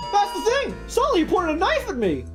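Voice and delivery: high, loud rookie cop insisting; eager young patrolman. Acted. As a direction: pitch it up and plead eagerly. Voices patrolman character in game.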